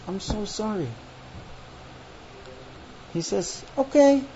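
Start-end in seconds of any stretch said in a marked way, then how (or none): 0.1-0.9 small, hushed sorry; soft apology. Acted. Wife to her upset husband, soft apology. 3.8-4.2 higher, sceptical okay; disbelieving okay. Acted. Husband answering his wife's apology, disbelieving. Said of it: "he says it in a tone like".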